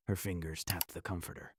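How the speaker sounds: usual speaking voice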